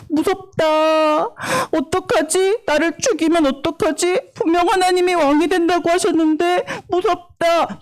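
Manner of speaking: high, drawn-out fretful voice; acted fear. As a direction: play someone frightened, hiding in a cave. Acts David hiding scared in cave.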